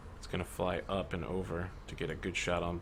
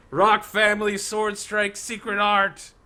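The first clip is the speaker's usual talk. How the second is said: slow, much higher, loud battle cry; anime attack shout; 12 semitones higher, 10 dB louder, slower than usual. Acted. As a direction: call out the special move slowly. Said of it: "Then he says".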